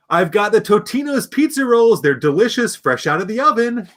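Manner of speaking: chirpy mom doing a product plug. Retold or imitated. Mom voice promo.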